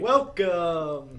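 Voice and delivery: loud, hyped-up announcer greeting; big over-the-top burst of enthusiasm; super excited. Shown as a demonstration. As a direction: belt it out like an excited radio ad. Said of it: "drive excitement and get 'em excited".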